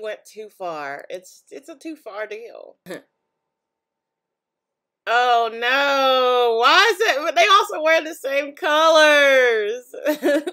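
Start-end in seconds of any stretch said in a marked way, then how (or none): 5.1-10.4 loud and high, slowed at the end; real dismayed yelling; horrified outcry. Real, not acted. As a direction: protest in disbelief. Horrified by a product combo.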